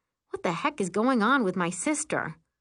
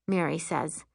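ordinary delivery

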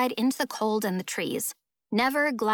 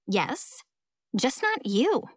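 pointed "just not you", lifting at the end; catty put-down, rising on "you"; pointed jab; much slower than usual. Acted. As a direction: deliver "just not you" as a quick dig. Rival's pointed jab to Amelia.

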